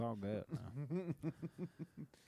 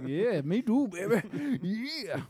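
high, loud goofy voice; goofy mock lover, pitched way up; silly put-on high voice; Adam Sandler; 8 semitones higher, 3 dB louder, slower than usual. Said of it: "Adam Sandler over here".

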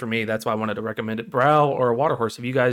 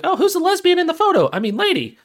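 put-on much higher, sugary church-girl voice; prim churchgoing girl; 11 semitones higher, 3 dB louder than usual. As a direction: falsetto pious girl. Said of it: "She's like".

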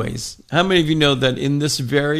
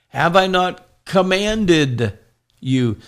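slowed, higher, louder on "Have I not commanded"; emphatic divine command; 4 semitones higher, 5 dB louder, slower than usual. Acted. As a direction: drive home "Have I not commanded you?" slow and strong. God's command, preached with emphasis.

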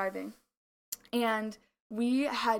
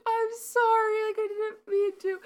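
mock pleading; 12 semitones higher, 4 dB louder than usual. Girlfriend apologising for spilled fries, high mock pleading.

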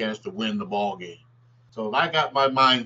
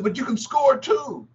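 Red Auerbach voice; 13 semitones higher than usual. Retold or imitated. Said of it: "he said".

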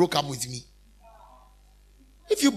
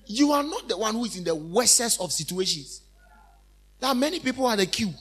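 usual speaking voice

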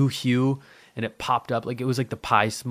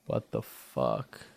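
lower, slow and soft; low slow disbelief; 9 semitones lower, 6 dB quieter, much slower than usual. Real, not acted. Spooked at the smoke leaving the house, slow low disbelief. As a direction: drag it out, stunned.

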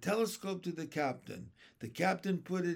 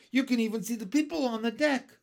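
quick, high, loud burst; excited; 7 semitones higher, 7 dB louder, faster than usual. Acted. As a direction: blurt it out in excitement. Children spotting a ship below.

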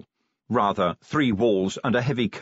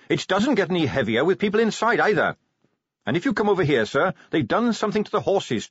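put-on higher eager young Carrot voice; 7 semitones higher than usual.

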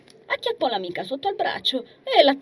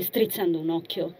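usual speaking voice

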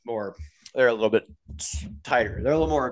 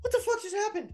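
much higher, softer stunned mock exclamation; baffled disbelief; 7 dB quieter than usual. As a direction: squeak it out like you can't believe what you saw. Speaker's own baffled reaction retold.

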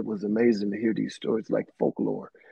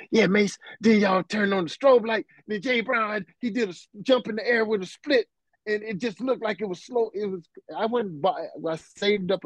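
much higher, eager and lively; excited retelling; 9 semitones higher than usual. Retold or imitated. Telling [name] about the [name] strobe show, excited and high. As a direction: relive the thrill of the show.

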